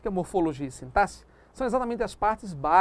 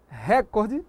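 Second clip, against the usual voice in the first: "recorde" stressed on the first syllable, RÉ-cor-de; slowly shown mistake, "recorde" as RÉ-cor-de; wrong stress; 2 semitones higher, 3 dB louder than usual. Shown as a demonstration. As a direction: punch the RE of "recorde". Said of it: "correta posição da sílaba tônica".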